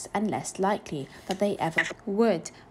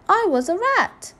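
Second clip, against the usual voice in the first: put-on very high, loud, slow child voice; slow, emphatic little-boy declaration; 12 semitones higher, 8 dB louder than usual. A narrator's reading. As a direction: insist on it as a small boy. Little boy repeats his answer.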